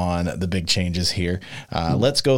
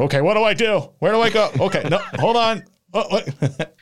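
flustered person; 8 semitones higher than usual. Retold or imitated. Pictures a confused person reading rules.

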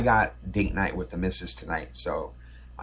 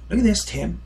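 much higher, thrilled pointing it out; excited; 12 semitones higher than usual. Retold or imitated. Player showing his friend a rare photo, excited and high. [name] points at [name].

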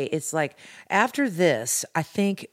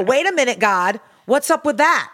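higher, louder mock indignation; retold pissed-off protest; 7 semitones higher, 5 dB louder than usual. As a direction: demand an explanation, playing up the annoyance. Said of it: "I get really pissed off".

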